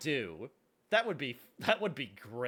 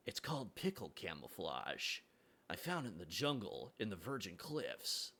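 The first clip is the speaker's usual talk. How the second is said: Solid Snake voice; 3 semitones lower, 6 dB quieter than usual. Pretend. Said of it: "your Solid Snake voice".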